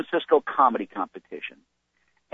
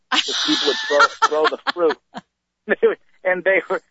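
much higher, laughing through it; 6 semitones higher than usual. Real, not acted. Comic telling heckled stand-up days, laughing. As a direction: laugh at the memory while telling.

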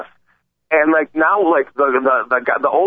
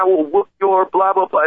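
ordinary talk, nothing marked

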